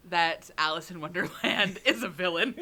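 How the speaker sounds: evil cackling laugh; villain laugh. Real, not acted. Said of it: "You just did the villain laugh".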